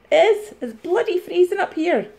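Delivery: high-pitched insistent moan; emphatic complaining. Acted. Reseller grumbles about the freezing shed, high and insistent. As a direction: insist on it in a high mock whine.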